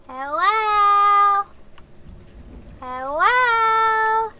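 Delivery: high, loud, long-held holler; calling out. Real, not acted. Calling into a weird courtyard, high drawn-out shout. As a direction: stretch the second syllable out loud.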